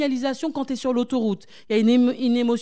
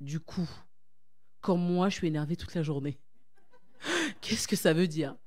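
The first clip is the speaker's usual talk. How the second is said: imitating audience; 4 semitones lower, 8 dB quieter than usual. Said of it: "vous allez me dire".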